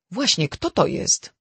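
crisp, demanding interjection; sharp. A narrator's reading. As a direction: cut in pointedly with the question. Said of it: "przerwała mu ostro".

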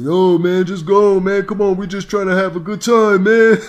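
hammed-up loud zombie partygoer; mock bystander. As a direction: holler like a dumb crowd guy egging him on. Zombie bystanders urging him on.

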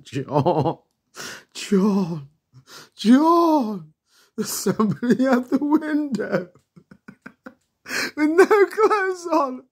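starts as a call, climbs to a very high laughing scream; building scream. Retold or imitated. Kids shrieking to John about the naked man, rising scream. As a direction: let it rise into hysterical shrieking.

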